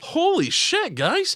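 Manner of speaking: a big jump up in pitch, incredulous; a hammed-up shocked outburst; mock disbelief. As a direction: act stunned by everyone's opinion. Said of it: "I'm like".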